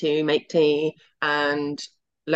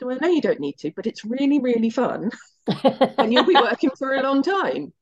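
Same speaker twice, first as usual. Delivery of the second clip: higher, lightly voiced coaxing father; dad persuading; 6 semitones higher than usual. Father coaxing his daughter to go to uni, lightly voiced and higher. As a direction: gently talk her into uni.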